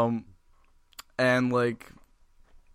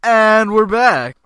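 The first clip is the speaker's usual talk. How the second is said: very high, loud, slow host call; showman announcement; 9 semitones higher, 13 dB louder, much slower than usual. Acted. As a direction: stretch it out like a game show host. Mock show re-opening.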